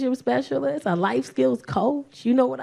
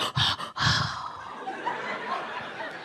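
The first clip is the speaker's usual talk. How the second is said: long, high mock-horrified gasp; hammed-up breathy gasp of shock; 8 dB quieter than usual. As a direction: gasp as if deeply scandalized. Boyfriend's shocked reaction to insult.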